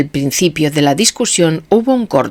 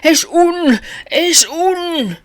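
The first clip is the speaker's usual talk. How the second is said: high, stuck and stammering; can't get the word out; stammering panic; 11 semitones higher, 4 dB louder, slower than usual. A narrator's reading. (tartamudeo angustiado)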